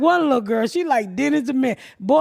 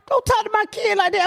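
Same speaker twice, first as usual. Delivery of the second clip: offended mother; 10 semitones higher than usual. Retold or imitated. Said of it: "She looking at me like".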